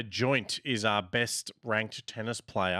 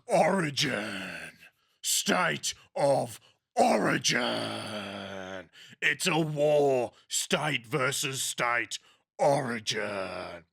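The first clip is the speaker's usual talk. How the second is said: slow, dramatic sports ad announcer; drawn-out epic promo read; hyped TV promo voice; slower than usual. Retold or imitated. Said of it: "they're like".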